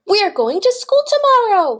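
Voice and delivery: high, loud, gleeful call; excited announcement. Acted. Farmer telling chickens about school, high excited call. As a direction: call out the big news.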